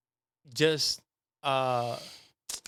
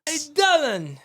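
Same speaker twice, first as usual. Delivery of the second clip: loud high stern call; stern shout; 10 semitones higher, 6 dB louder than usual. Pretend. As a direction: shout for Dylan like a boss. Mock angry boss calling.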